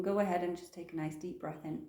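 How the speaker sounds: soft, calm meditation guidance; guided meditation. Acted. Yoga teacher guiding the class into stillness, soft meditation voice. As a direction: ease them gently into the breath.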